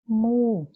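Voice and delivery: "mu" isolado, mais alto e forte; sílaba tônica destacada. Shown as a demonstration. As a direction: destaque o "mu" sozinho, com força. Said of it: "Onde foi que eu segurei mais?". ("mu" solto, dito com força e mais agudo)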